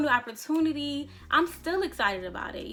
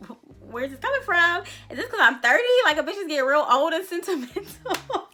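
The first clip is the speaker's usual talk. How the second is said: mock self-questioning; 6 semitones higher, 7 dB louder than usual. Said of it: "And I'm like".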